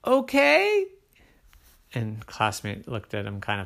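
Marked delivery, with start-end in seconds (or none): 0.1-0.9 high, loud okay; high squeaky okay; eager Oren. A narrator's reading. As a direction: blurt it out brightly. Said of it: "Oren was like".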